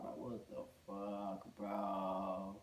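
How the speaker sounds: slow, low, hushed dismay; hushed disbelief. Retold or imitated. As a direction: drag it out under your breath. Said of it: "muttered, stunned".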